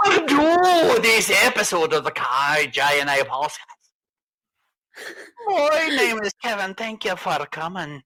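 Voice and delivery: lightly put-on Scottish host voice; hint of a Scottish host; Scottish accent. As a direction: sign off in a mock Scottish brogue. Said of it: "All right, Scotty".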